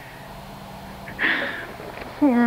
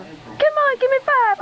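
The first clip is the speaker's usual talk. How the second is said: much higher, coaxing a cat; squeaky coaxing; 13 semitones higher than usual. Real, not acted. Owner begging her cat for a high five, squeaky. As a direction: jump up into a squeaky plea.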